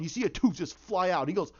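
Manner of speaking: ordinary talk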